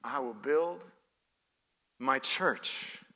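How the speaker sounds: soft, low and halting; hushed repetition. Real, not acted. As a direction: let it sink in quietly, pausing mid-phrase. Same verse repeated softly after pause.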